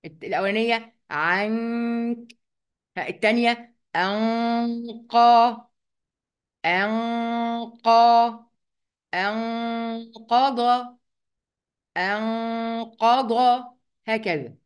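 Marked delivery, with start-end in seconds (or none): 1.1-2.4 "عنك" with a long held nasal hum for the n; hidden noon with ghunnah. Shown as a demonstration. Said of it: "بنخبيها شوية". 4.0-13.3 "أنقض" with the n drawn out as a level nasal hum; hidden noon with ghunnah. Shown as a demonstration. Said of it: "الغنة اللي هي الصوت اللي بيطلع من المناخير".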